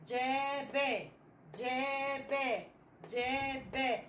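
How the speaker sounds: جاب slowly drawn out as jaa-ba; slow, even jaa, then ba; blending sounds. Shown as a demonstration. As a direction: sound out جاب in two long syllables. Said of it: "نقول دول مع بعض".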